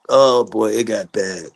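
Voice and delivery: ordinary talk, nothing marked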